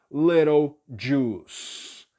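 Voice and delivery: "little" and "juice" drawn out slowly; careful model pronunciation. Shown as a demonstration. Teacher gives the English for pouco suco, slowly. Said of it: "Então eu digo".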